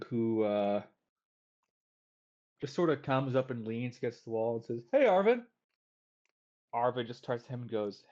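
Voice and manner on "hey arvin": high, loud taunting hey; cocky Ren greeting. Acted. Half gnome kid greets Arvin, high and loud. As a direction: call out to him with a smirk, pitched up.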